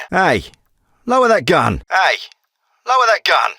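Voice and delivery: high, urgent warning; sharp command. Acted. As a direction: order an armed person to stand down. Armed standoff, ordering gun down.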